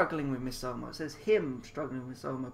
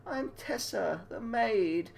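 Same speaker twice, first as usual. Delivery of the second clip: tearful maid; 7 semitones higher, slower than usual. Acted. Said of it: "In a voice choked from tears".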